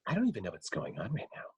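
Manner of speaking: quick, quiet, faintly lisped celebrity impression; Ryan Reynolds. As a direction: rattle it off low-key through a slight lisp. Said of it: "here's Ryan Reynolds doing the same thing".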